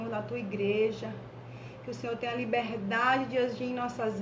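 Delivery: soft, measured congregational prayer. Real, not acted. Church leader asking God's presence over the gathering, praying softly. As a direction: lead the church gently in earnest prayer.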